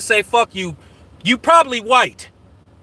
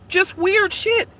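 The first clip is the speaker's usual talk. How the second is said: high mocking voice; 12 semitones higher than usual. Real, not acted. Ends rant on fishy boxing.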